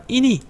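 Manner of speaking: 이니 sung up high as a question; question rise. Shown as a demonstration. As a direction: lift 이니 sharply like a question mark. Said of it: "물음표가 있으니까".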